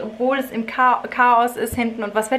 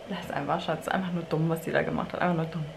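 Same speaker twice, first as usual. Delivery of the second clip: soft and low, soothing; calming husband; 3 semitones lower, 8 dB quieter than usual. Retold or imitated. Husband soothing his angry wife, soft calming voice. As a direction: settle her down gently.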